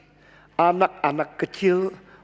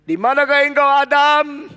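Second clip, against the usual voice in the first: high and loud calling; God calling; 9 semitones higher, 7 dB louder, slower than usual. Retold or imitated. God calls out to the hiding Adam, high and loud. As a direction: call out across the garden. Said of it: "when God say".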